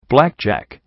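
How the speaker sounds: shouted. A narrator's reading. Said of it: "P twenty-one shouted".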